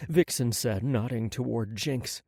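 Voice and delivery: ordinary delivery, nothing marked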